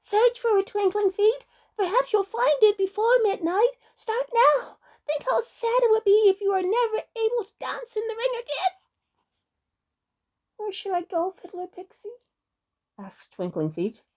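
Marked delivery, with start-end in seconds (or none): 0.0-8.7 high-pitched clamour of little pixies; urgent cries. A narrator's reading. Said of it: "one after another they cried out". 10.6-12.1 high, small questioning voice; anxious little pixie. A narrator's reading. Worried little pixie asks for guidance.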